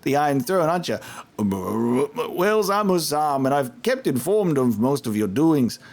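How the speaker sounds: a hint of a scrappy street accent; Husam's rough accent. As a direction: informant greeting the mercenaries, rough accent. Voices Husam.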